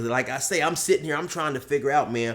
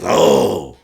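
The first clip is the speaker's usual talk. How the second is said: low, loud dawning realisation; Polar Bear; 9 semitones lower, 9 dB louder than usual. Acted. Buddy finally understanding Josh, low loud realisation. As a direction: drop the pitch as it sinks in. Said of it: "Polar Bear says".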